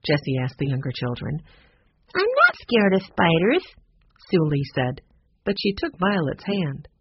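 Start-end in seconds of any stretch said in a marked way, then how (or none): 2.1-3.6 put-on high, emphatic child's voice; defiant little girl. A narrator's reading. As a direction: insist like a small child. Young girl insisting in story.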